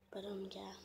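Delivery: faint whispered trailing off; sad whisper. Real, not acted. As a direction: let it fade to a whisper. Trailing off after sad remark.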